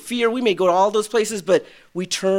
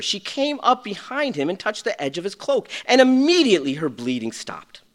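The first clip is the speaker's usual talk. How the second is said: ordinary talk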